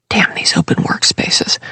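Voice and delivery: soft whispered curse; whispered grumble. Acted. Jude cursing the open office, under the breath. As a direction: curse quietly so no one hears.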